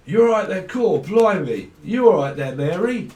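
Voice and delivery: loud, hammed-up Cockney greeting. Greeting Mary Poppins in a loud mock Cockney. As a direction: call out like a cheery Cockney. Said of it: "Someone's a Cockney".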